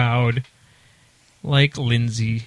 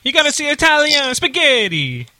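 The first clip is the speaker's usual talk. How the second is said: mock Italian accent; 11 semitones higher than usual. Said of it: "speak with an Italian accent".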